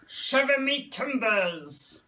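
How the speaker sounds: mild slow pirate voice; teacher repeating the pirate phrase for kids. Shown as a demonstration. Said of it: "what other words can we do".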